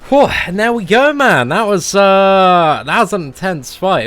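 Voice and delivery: loud, high-pitched victory exclamation; relieved triumph. Real, not acted. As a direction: let the tension out after an intense fight. Relief on winning intense fight.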